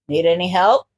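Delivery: low, gruff hero voice. A narrator's reading. Ground Zero brushes off Izuku's apology, low and gruff. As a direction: shrug it off, rough and casual.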